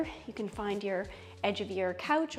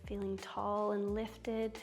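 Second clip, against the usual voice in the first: soothing yoga teacher; 8 dB quieter, slower than usual. Acted. Guides yoga poses slowly.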